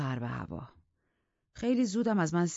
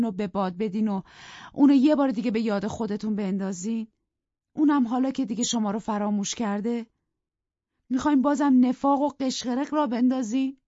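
raised, louder pleading objection; heated protest; 7 semitones higher, 6 dB louder than usual. Acted. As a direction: push back hard at him, voice climbing. Nelly protests to Heathcliff.

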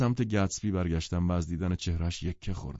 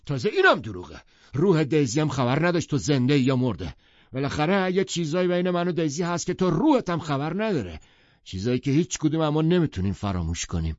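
loud, higher furious outburst; savage; 9 semitones higher, 7 dB louder than usual. Acted. Tom lashes out at Gatsby, loud and furious. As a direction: hurl it at him in rage. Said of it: "تام وحشیانه گفت".

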